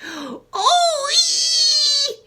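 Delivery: very high, loud scream. Acted. Grandma screaming after the second dose. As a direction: crone shrieking as the dose hits. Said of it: "she screamed".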